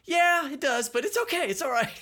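high retold quote of friend. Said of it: "And you were like".

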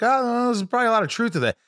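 high, fast, mock knowing tone. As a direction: play it like gossip. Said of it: "you're like".

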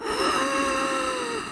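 soft, high wheezing inhale; faint strained gasp for air; imitated gasp. Imitates daughter struggling to breathe.

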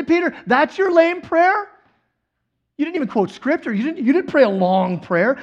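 mock rebuke. Imagined Jesus faulting Peter's short prayer, mock rebuke. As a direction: scold him in disbelief, hammed up.

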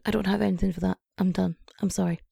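ordinary talk

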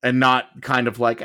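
usual speaking voice

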